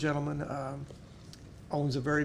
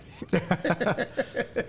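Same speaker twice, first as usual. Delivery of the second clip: loud, high burst of real laughter; hearty laugh; 6 semitones higher, 4 dB louder than usual. Real, not acted. Laughing out loud at a caller's flattering question, delighted laugh.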